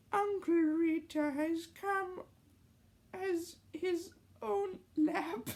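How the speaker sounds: put-on high child voice; squeaky young voice; kid voice. Character high voice.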